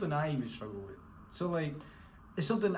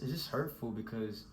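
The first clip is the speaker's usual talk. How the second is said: ordinary delivery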